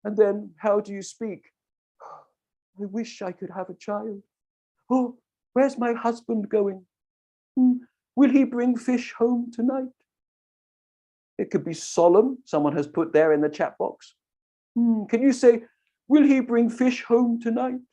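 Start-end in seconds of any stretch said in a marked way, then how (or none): 2.8-9.9 softer, higher, sadder wife; wistful and soft, wondering; wife longing for a child and her husband; quiet sad wife. Shown as a demonstration. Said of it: "quieter, softer, and sadder". 16.1-17.8 softer, higher, sadder wife; wistful and soft, wondering; wife longing for a child and her husband; quiet sad wife. Shown as a demonstration. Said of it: "quieter, softer, and sadder".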